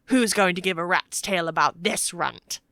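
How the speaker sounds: usual speaking voice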